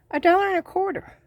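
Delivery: high, fast amazed echo of the price; can't believe how cheap it was; amazed repeat. Real, not acted. Telling viewers the price, high with amazement.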